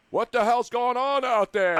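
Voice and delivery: Lombardi screaming. Retold or imitated. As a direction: holler it like an old-school coach, half-scale. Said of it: "I mean, Lombardi screaming".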